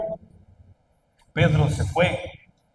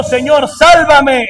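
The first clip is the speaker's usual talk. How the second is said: louder, higher, slow cry of despair; desperate cry; 7 semitones higher, 9 dB louder, slower than usual. Retold or imitated. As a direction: cry out Señor, sálvame like a sinking man. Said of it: "es un grito de desesperación".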